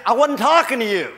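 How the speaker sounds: exasperated self-defense, played for laughs; mock indignant protest. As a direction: push back loudly at an imagined critic. Defending himself to imagined critic.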